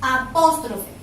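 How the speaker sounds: "Apóstrofe" with a firm hit on PÓS; stress on the second syllable. Shown as a demonstration. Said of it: "Cargo la voz aquí". (apóstrofe recalcando la sílaba PÓS)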